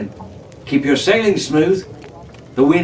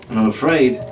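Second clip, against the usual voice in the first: ordinary delivery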